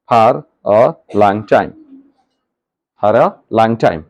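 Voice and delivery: very slow and deliberate; slow dictation. Shown as a demonstration. Teacher dictates the finished sentence to the class, very slow. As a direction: read it word by word for copying.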